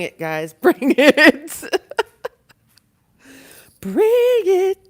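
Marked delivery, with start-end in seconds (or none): 0.6-1.6 very high, loud squeal with laughter; high-pitched hype yell; excited shriek. Real, not acted. Hyping up incoming stream raid. 3.8-4.8 piercingly high, loud held-out cry; very high, loud hype call; excited squeal. Real, not acted. Hyping up incoming stream raid.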